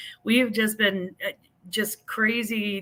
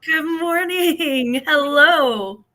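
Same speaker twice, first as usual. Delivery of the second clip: slow, high, drawn-out good morning; sing-song greeting; 7 semitones higher, 5 dB louder, much slower than usual. Acted. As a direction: answer the fanfare with a grand sung hello. Guest answers the hyped introduction.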